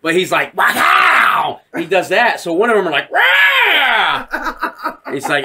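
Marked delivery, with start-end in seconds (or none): 0.6-1.6 high, loud saber whoosh; imitated lightsaber lunge. Retelling the Emperor attacking Jedi, imitated lightsaber noise. 3.1-5.0 long, high imitated scream; mock death cry. Jedi cut down in a retold fight, mock death scream.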